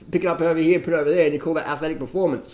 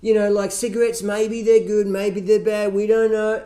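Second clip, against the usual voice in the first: high, loud, dithering mock of the researchers; 6 semitones higher, 5 dB louder than usual. Ridiculing the study doctors' vague conclusion, high and loud. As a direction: ham up their useless shrug, pitched up and loud.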